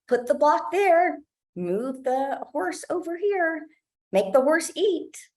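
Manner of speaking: high, bossy directing parent voice; lightly voiced bossy parent telling the child what to do. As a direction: mimic a parent ordering the child around. Acted directive parent at play.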